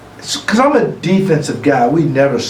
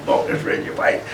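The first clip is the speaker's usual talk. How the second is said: whispered aside; 7 dB quieter than usual. Retold or imitated. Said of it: "I'm like".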